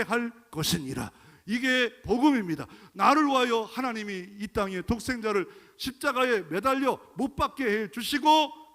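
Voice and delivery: loud, rising preacher's cry; full-force preaching. Acted. Preacher crying out to the congregation, loud and rising. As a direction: preach it out with mounting fervor.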